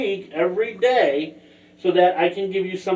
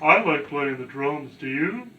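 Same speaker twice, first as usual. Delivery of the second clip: lightly voiced Patrick; 4 semitones lower, 5 dB quieter than usual. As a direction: slightly lower dopey starfish. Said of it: "Patrick's funny too".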